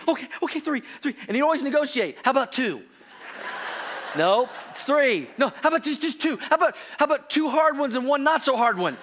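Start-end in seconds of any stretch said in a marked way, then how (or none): 0.1-1.2 put-on child resigned. Son accepts count. 2.2-9.0 put-on bargaining child. Son negotiates.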